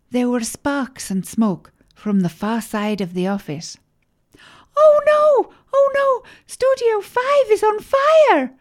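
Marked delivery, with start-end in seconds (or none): 4.8-8.5 high-pitched loud squeal of alarm; very high, loud cartoonish panic; panicked scream. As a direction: scream it out as the secretary raising the fire alarm. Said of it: "screamed the secretary".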